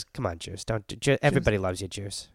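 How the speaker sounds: low, hushed coaxing; imitating a soft, pleading negotiator; negotiator's soft plea. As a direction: talk him down gently and quietly. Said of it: "all that dude says is".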